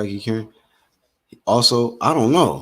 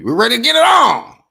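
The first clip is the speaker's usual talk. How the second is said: Mills Lane; 14 semitones higher, 6 dB louder than usual. Retold or imitated. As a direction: belt it like the famous boxing referee. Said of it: "My Mills Lane boy".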